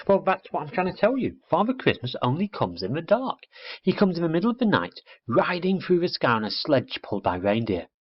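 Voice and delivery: put-on little girl telling Plop about Father Christmas; impatient little girl. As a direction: rush the explanation like an excited child. Girl's voice in the story.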